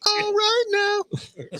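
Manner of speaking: very high, drawn-out slow exclamation; mock Southern holler.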